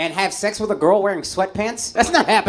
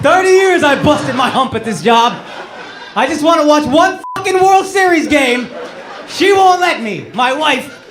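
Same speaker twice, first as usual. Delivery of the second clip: loud, high mock tirade; hammed-up aggrieved shouting; angry union-worker impression; 6 semitones higher, 6 dB louder than usual. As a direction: rant like a fed-up working husband. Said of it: "There's too much anger".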